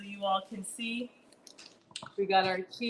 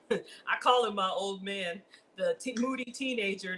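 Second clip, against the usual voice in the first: ordinary talk, nothing marked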